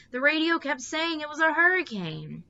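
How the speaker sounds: high, loud frightened wail. A narrator's reading. Meg wails to her mother about the hurricane, high and loud. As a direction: wail it out, scared of the storm. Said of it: "Meg wailed".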